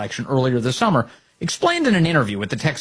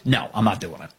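mock flat refusal; 5 semitones lower, 4 dB quieter than usual. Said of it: "Cornyn says".

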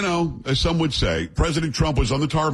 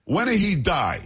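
put-on higher-pitched Italian-accented woman; imitated bold Italian woman; thick Italian accent; 7 semitones higher than usual. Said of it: "with a thick, uh, um, Italian accent".

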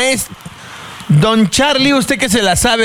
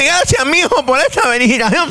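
lightly voiced dad; 8 semitones higher than usual.

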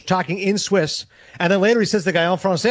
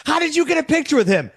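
astonished outcry; 8 semitones higher than usual. Retold or imitated. Envious people outside confronting the reporter, acted astonished outcry. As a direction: imitate stunned onlookers, pitched way up.

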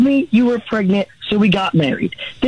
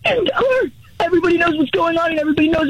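exasperated retort; 8 semitones higher than usual. Real, not acted. Husband snaps back in argument.

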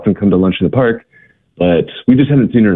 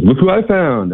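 higher, cheerful reveal; proud announcement; 9 semitones higher than usual. Retold or imitated. John presenting Rhonda to the missionaries, higher cheerful reveal. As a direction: show her off, pleased. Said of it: "John comes and says".

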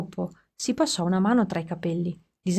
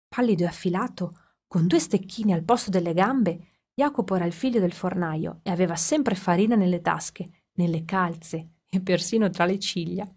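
ordinary delivery, nothing marked

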